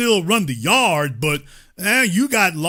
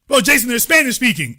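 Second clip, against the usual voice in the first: higher, quick mock objection; mimicked objector; 5 semitones higher, faster than usual. Imitating a listener objecting to the host, mock protest. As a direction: parrot a naive caller's protest.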